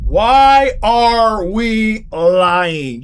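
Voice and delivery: drawn-out, very slow question; slow, exasperated plea. Real, not acted. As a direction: stretch every word out in disbelief. Exasperated at the cop lying.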